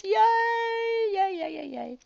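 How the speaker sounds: high, loud drawn-out yay, then falling away; celebratory cheer. Pretend. Host cheering the two hundred fifty dollar saving, high celebratory whoop.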